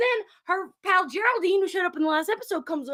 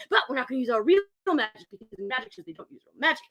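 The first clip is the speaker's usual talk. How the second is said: low, rapid disclaimer; hurried aside; 6 semitones lower, faster than usual. Retold or imitated. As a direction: rattle it off low like small print. Confidential aside within magic show bit.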